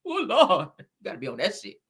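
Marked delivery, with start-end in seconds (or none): 0.0-0.7 mock ugly cry. Shown as a demonstration. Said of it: "You gotta put your hand like this".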